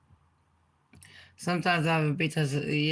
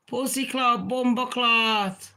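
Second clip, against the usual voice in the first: higher, slow patois swearing; Jamaican patois curse; 6 semitones higher than usual. Shown as a demonstration. Demonstrating patois swear words to viewers, Jamaican patois curse. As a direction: drag out bumper clot in patois. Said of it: "that is patois".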